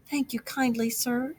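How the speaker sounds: a sly Toad faking weakness, soft and frail; feeble voice. A narrator's reading. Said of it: "said the Toad in a feeble voice".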